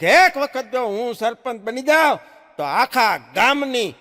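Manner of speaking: loud, slow, boastful yell; drunken bellow. Retold or imitated. Drunk husband bellowing boasts at home, loud and slow. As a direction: bellow like a drunk making big promises. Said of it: "ઘરમાં બરાડા પાડતો".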